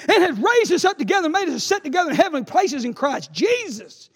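loud, high scripture reading; proclaimed scripture. Acted. Preacher reading Ephesians to the church, loud and high. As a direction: thunder out the verse.

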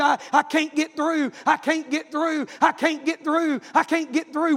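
urgent revival-meeting storytelling; fervent preaching. Acted. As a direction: preach it hard, phrase after phrase. Preaching at full force.

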